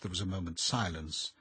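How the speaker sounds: usual speaking voice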